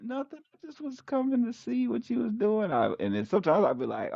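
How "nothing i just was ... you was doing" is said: much higher, innocent wife voice; wife's voice. Retold or imitated. Husband imitating wife innocently explaining herself, high voice. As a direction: sound like she's only curious, nothing more.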